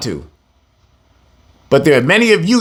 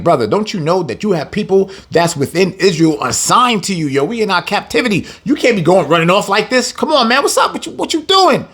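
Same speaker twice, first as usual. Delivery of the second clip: talking trash; 3 semitones higher than usual. Retold or imitated. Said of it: "the brother started to talk trash".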